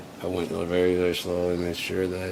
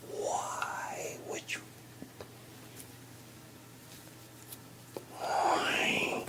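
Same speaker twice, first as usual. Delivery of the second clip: anguished whisper; 8 dB quieter, much slower than usual. Acted. Wounded whisper at coworker.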